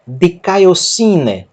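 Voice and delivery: each syllable drawn out very slowly; slow Greek demo. Shown as a demonstration. Teacher models Greek for students, very slow. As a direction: spell the word out slowly for learners. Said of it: "Observe a presença do ditongo alfa e iota".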